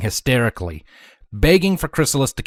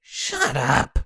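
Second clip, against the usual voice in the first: snapping. A narrator's reading. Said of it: "snapped at both".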